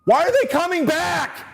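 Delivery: exasperated high shout; frustrated shout. Real, not acted. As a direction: shout it at the trucks in disbelief. Yelling at garbage trucks outside.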